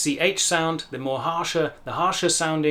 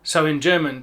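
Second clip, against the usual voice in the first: usual speaking voice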